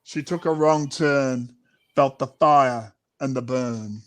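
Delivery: usual speaking voice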